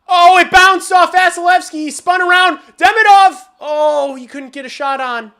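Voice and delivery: high, loud excited play-by-play; excited play call. Real, not acted. Streamer calling a wild scramble live, high and loud.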